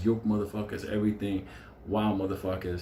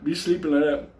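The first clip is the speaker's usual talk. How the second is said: higher, louder mock plea; hammed-up begging for his bail; mock panic; 7 semitones higher, 4 dB louder than usual. Inmate begging the court to bail the killer, mock-panicked.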